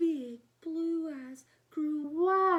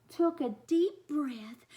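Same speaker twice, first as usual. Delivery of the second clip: ordinary delivery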